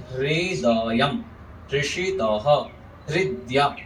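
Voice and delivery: हृदयम drawn out slowly as "hree-da-yam"; pronunciation demo. Shown as a demonstration. Teacher corrects a common error in हृदयम, careful demo. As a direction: say हृदयम, हृषितः, हृदया word by word, "hri" first. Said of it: "ऐसे उच्चारण करेंगे".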